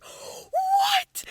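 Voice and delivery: very high, drawn-out mock squeal; shocked shriek. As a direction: squeal it in exaggerated disbelief. Quest stunned by the magic.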